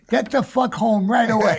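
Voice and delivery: husband on the phone ordering his wife home, yelling; retold yell. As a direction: bark it like a frantic husband.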